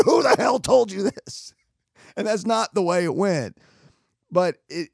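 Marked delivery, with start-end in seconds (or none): 0.0-1.5 embellished furious shout. Retold or imitated. Buddy retelling the rumor confrontation for humor, hammed-up shouting. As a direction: exaggerate the confrontation for laughs. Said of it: "it was embellished for the sake of".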